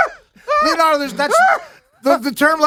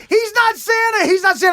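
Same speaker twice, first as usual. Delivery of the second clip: mock shout; 12 semitones higher than usual. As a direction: shout it like an outraged man-child. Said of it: "he pulls my beard and goes".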